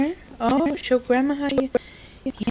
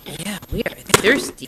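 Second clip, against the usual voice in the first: ordinary delivery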